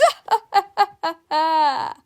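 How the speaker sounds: high, loud villain cackle; gloating laugh. A narrator's reading. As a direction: cackle in triumph. Principal gloats over suspension.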